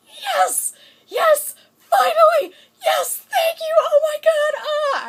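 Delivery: tearful, joyful screaming at the screen; ecstatic fan scream. Retold or imitated. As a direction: shriek it out like a fan whose ship just kissed. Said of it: "I was kind of in tears, like".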